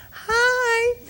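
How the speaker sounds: very high, chirpy sing-out hello; mock happy greeting. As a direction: imitate an over-cheery coworker in a squeaky high pitch. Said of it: "she's all happy".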